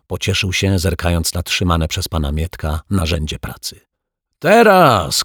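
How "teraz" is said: sharp, much higher, louder yell of now; fireman's loud call to act; shouted command. Acted. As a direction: firefighter barking the signal to spray. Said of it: "krzyknął któryś ze strażaków".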